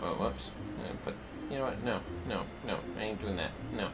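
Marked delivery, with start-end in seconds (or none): none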